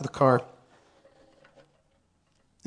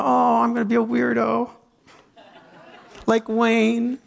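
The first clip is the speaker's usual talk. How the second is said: self-mocking; 7 semitones higher than usual.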